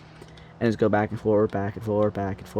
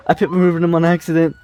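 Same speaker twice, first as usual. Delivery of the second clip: excited; 6 semitones higher, 6 dB louder, faster than usual. Real, not acted. Repeats after catch.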